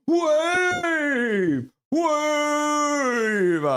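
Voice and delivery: high, long-held call falling away; crowd-wave cheer. Acted. Streamer voicing a viewer starting the wave, long cheer. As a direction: hold it high, then let it slide down.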